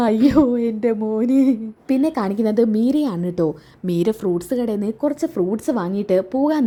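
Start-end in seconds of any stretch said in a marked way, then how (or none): 0.1-1.4 slow, drawn-out lament; mock sobbing mother; acted wailing. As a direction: wail for the son, hammed up. Mother laments her injured son.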